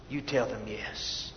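soft, low repetition; hushed echo of the urging; soft repeated yes. Real, not acted. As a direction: repeat it gently for weight.

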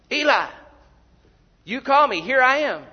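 loud, high acted boy's call; eager calling out. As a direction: report in loudly to the priest. Boy returns a third time.

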